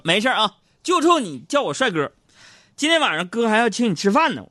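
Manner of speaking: high, loud big-brother bravado; magnanimous swagger. Retold or imitated. Man flattered by the girl who hit him, expansive swagger. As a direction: brush it off with grand generosity. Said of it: "我大气一挥".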